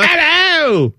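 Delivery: very high mock South African accent; bad South African accent. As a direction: squeal a badly faked South African greeting. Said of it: "That's your South African?".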